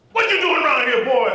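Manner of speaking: put-on loud, high challenge from a teen; threatening shout. Teenager yells at the kid watching from the fence, loud. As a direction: shout him down as an intruder.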